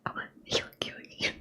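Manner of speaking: whispered cartoon catchphrase sound; Goofy. Shown as a demonstration. Reader recalling the sound Goofy always makes, whispered cartoon impression. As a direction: imitate the cartoon dog's chuckle under whisper. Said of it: "That's him".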